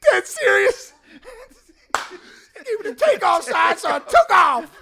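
high, loud, and laughing through the words; very high laughing Ricky impression. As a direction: squeeze the Ricky line out between laughs. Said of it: "He's like".

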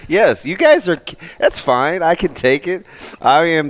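amused mock hurt; laughing. Real, not acted. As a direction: laugh off the teasing. Laughing through teasing.

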